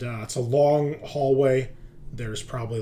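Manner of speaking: ordinary talk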